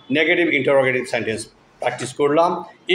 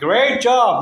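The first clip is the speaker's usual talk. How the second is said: excited praise; 6 semitones higher, 4 dB louder than usual. Real, not acted. Teacher praising a child's good score, excited.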